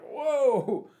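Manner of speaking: high, drawn-out whoa of surprise; startled whoa. Retold or imitated. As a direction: let it swoop up high, pleasantly taken aback. Startled by the unexpected hug.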